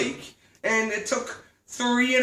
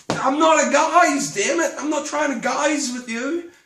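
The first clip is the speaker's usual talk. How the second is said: indignant; 5 semitones higher than usual. Acted. Customer fed up with being called guys, indignant. Indignant retort to shop staff.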